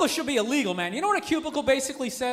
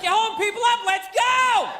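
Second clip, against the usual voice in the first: high, hollered mock conductor's scolding; impatient imitation yell, building to the end; giving a rough time; 8 semitones higher, 3 dB louder than usual. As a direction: shout at the one holding everyone up. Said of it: "starts giving the guy a rough time".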